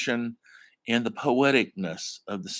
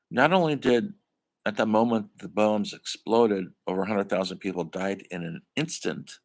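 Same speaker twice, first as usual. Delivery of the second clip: usual speaking voice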